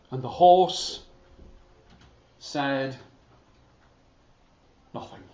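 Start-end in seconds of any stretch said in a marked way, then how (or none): none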